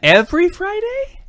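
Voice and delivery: very high, slow incredulous aside; Jim Gaffigan. Acted. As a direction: squeeze it into a high, drawn-out comic question. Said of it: "That was my Jim Gaffigan impression".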